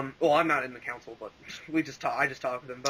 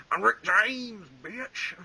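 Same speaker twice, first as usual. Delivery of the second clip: hammed-up [name] impression; a mock deep [name]; 7 semitones higher, much slower than usual.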